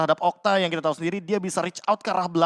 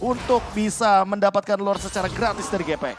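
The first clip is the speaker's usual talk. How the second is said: screaming play-by-play, much higher; esports caster; 8 semitones higher, 5 dB louder than usual. Real, not acted. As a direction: yell the big play at full hype. Hyped live caster call.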